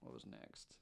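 soft unvoiced whisper. Real, not acted. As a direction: whisper it to yourself. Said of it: "whispered aside".

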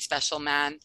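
ordinary delivery

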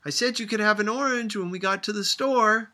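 loud, firm warning; warningly. A narrator's reading. Grandmother calling to Sophia with a loud warning. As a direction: call out a firm reminder. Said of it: "called grandmother warningly".

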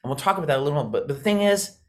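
normal talking voice

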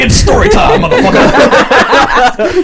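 laughing, shouted hype announcement; hyped shout. Real, not acted. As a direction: yell it out, cracking up. Game host kicks off, hyped.

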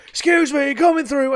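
high loud excuse me, coming through; loud barging call. Retold or imitated. Blocker clearing the crowd with beer, high and loud. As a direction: call it out while charging through. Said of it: "basically go".

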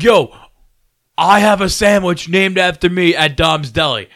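put-on loud, slow drunken brag; drunk boasting. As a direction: boast it loudly, slow and proud.

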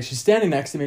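ordinary talk, nothing marked

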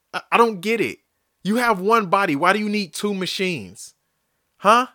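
ordinary talk, nothing marked

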